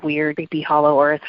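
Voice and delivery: usual speaking voice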